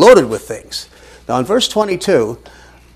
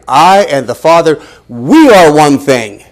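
slow and loud on we are one thing; emphatic translation; 8 dB louder, slower than usual. Shown as a demonstration. Preacher giving his own translation, slow and emphatic. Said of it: "I would translate".

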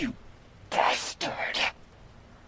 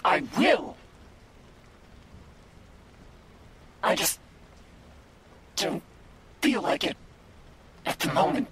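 furious demand; 4 semitones higher than usual. Acted. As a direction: roar demands at the captor. Captive demon demands answers.

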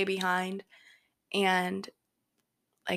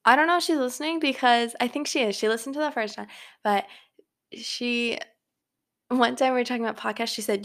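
ordinary delivery

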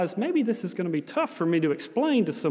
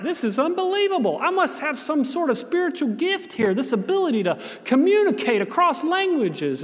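amazed; 8 semitones higher than usual. Retold or imitated. Inner thought, growing amazement.

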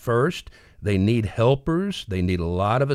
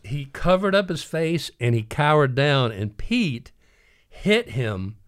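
usual speaking voice

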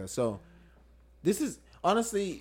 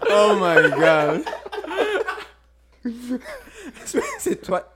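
laughing; 7 semitones higher, 6 dB louder, much slower than usual. Real, not acted. Laughing at the guest's name.

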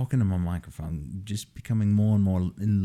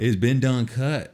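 slow, louder hammed-up Southern drawl; slow mock Southern drawl; 5 semitones higher, 5 dB louder, slower than usual. Cohost answers in mock Southern drawl, slow and loud. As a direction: stretch it out like a Southern colonel.

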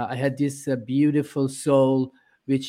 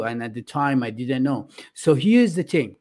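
usual speaking voice

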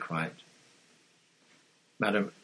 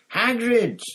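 high, loud call through a door; shouting; 14 semitones higher, 8 dB louder than usual. A narrator's reading. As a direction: holler his name to fetch him out. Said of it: "Hermione shouted".

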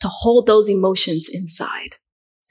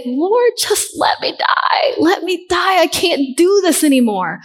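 voicing Job's anguish; 7 semitones higher than usual. Preacher voicing Job crying out to God, high anguished plea. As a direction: cry out to God in despair. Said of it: "Job cried out to God and said".

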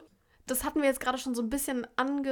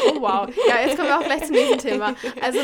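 loud laugh through the words; 9 dB louder than usual. Real, not acted. Sister laughs at being blamed, speaking through loud laughter. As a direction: burst out laughing while talking.

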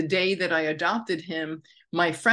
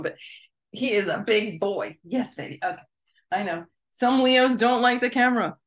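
ordinary talk, nothing marked

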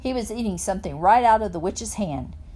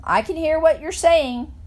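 higher, louder defiant holler; hollering back; 7 semitones higher, 5 dB louder than usual. A narrator's reading. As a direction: call back loudly to the boys behind. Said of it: "I hollered back at them".

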